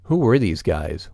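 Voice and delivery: ordinary delivery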